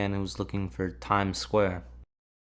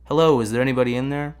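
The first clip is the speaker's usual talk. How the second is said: louder, higher call; 5 semitones higher, 7 dB louder than usual. Acted. Calling to someone who seems absent, loud and raised. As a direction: call out to see if anyone is there.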